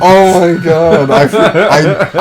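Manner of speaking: stunned laugh. Real, not acted. Stunned by plot twist.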